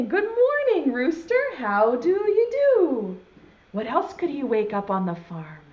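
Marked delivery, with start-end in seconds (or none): 0.0-3.2 high, singsong morning greeting; animal's greeting. Acted. Cow greeting the rooster in a children's poem, singsong.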